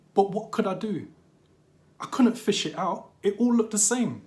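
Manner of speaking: high, loud, mortified outburst; horrified panic. Acted. Child horrified at soiling mum's ashes, high distraught outcry. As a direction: protest in rising horror and helplessness.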